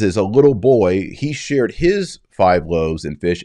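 usual speaking voice

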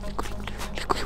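breathy whisper. Acted. Spotting the creature while hiding from it, whispering. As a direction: whisper so the creature won't hear. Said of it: "أخفضي صوتك مشان ما يسمعنا".

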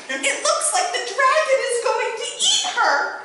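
a breathless son blurting it out to his mother; excited little boy. A narrator's reading.

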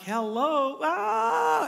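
high, loud frustrated yell; screaming at the TV. Retold or imitated. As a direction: yell at the quarterback through the screen. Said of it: "I realized I'm screaming in the hotel room".

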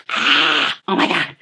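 retold jump-scare gasp; startled reaction, played up; startled yelp. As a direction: gasp and blurt it in mock fright. Retold scream at the phone jump scare.

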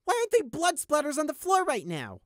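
very high, loud scornful tirade; contemptuous rant. Acted. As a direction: berate the guard with scorn. Prey rants at guard.

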